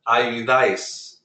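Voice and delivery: ayudáis slowly, stressed on final DÁIS; accent-mark stress. Shown as a demonstration. Teacher repeating ayudáis for the class, slow, stressed on DÁIS. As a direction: land on the one-syllable DÁIS ending of ayudáis. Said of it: "stress that letter instead of".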